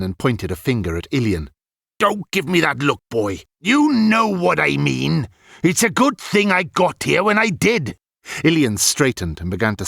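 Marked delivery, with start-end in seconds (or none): 2.0-8.0 put-on stern old man. A narrator's reading. Old wizard scolding boy.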